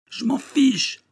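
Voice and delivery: high, strained yell of a man beside himself; desperate yell. A narrator's reading. Condemned man yelling at the judge, beside himself. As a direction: hurl it at the judge in despair. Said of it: "hurla le malheureux hors de lui".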